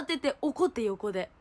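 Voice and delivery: ordinary talk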